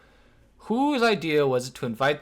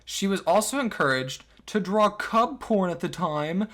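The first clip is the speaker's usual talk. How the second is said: usual speaking voice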